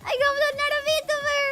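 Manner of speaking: very high, fast, squeaky. Real, not acted. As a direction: crank the pitch way up, giggly. Pitched-up voice, far timbre.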